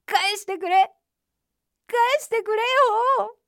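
high wail on the verge of crying; tearful plea. A narrator's reading. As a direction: beg helplessly, about to burst into tears. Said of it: "ウサギは泣き出しました". (泣きそうな高い声で訴える)

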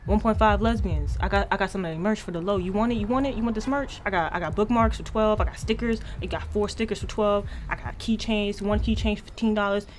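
fast-talking corner merch seller; street hustler. Acted. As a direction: hawk it like a street vendor. Hawks merch in a skit.

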